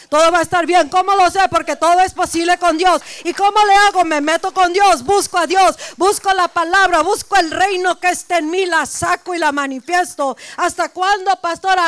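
loud, driving preacher's cry; full-force preaching. Acted. Pastor rousing her congregation, full-force preaching.

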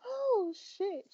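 ordinary delivery

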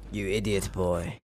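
low, quiet growl of scorn; deep scolding voice. Acted. Chef scolds boy.

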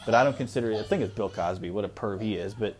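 ordinary delivery

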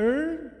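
"er" as a high, drawn-out Minas Gerais curled r; mineiro r sound. Shown as a demonstration. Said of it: "Aprende a falar".

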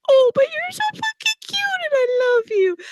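very high gushing over the puppy; doting baby talk. Retold or imitated. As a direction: squeal with love at a cute dog. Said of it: "you're like".

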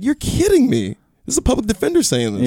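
high incredulous voice; disbelief. Retold or imitated. Comic stunned by his own lawyer, high disbelief. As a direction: pitch way up, stunned. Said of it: "I'm like".

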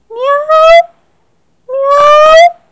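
very high, loud meowing; cat meow. Acted. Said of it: "y maulló".

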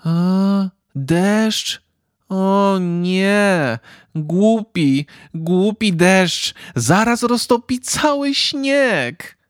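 put-on high child voice, slow and let down; a little boy groaning at the rain; disappointed boy. A narrator's reading. Boy at the window, disappointed by rain, high whine. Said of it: "Powiedział rozczarowany chłopiec".

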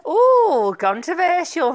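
high, loud gleeful squeal; camp delighted ooh. Real, not acted. Radio host squealing at her own cheeky remark. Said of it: "I'm a right one today".

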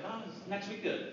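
soft, understated question; hiding shock. Retold or imitated. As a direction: play it down casually, softly. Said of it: "I tried not to let it show".

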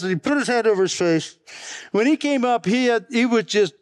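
normal talking voice

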